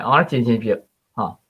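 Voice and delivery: ordinary talk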